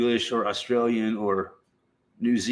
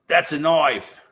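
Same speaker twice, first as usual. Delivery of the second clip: mock Australian accent; 4 semitones higher, 3 dB louder, slower than usual. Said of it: "that's how Americans learn to speak Australian".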